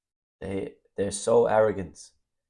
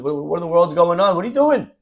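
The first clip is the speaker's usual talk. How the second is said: high, louder, baffled outburst; confused rabbi; 6 semitones higher, 6 dB louder than usual. Retold or imitated. Rabbi seeing the husband propose again, baffled outcry. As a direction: react as if nothing makes sense. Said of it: "the rabbi's confused".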